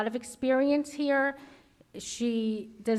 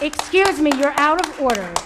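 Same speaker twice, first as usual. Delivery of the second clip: louder, firm call to order; 3 semitones higher, 9 dB louder than usual. Real, not acted. Board chair to the speaker, a loud firm call to order. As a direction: cut her off and call the meeting to order.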